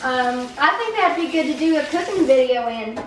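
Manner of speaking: animated Southern US English; Southern accent. Shown as a demonstration. Child pitches a cooking video, Southern accent. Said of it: "we should do an Southern accent".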